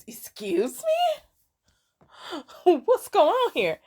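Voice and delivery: high, incredulous excuse me breaking into a laugh; can't believe what she just heard; laughing disbelief. Retold or imitated. Retelling Vivi's reaction to the stranger, amused high disbelief. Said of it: "It's like".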